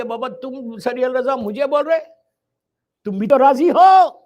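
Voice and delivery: loud, high, rising sermon cry; forceful preaching. Acted. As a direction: preach it out with full force to the crowd.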